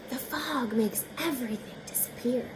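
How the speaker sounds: hushed whisper. A narrator's reading. Reader hushing the page about the fog, whispered. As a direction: breathe it like fog rolling in. Foggy mysterious narration.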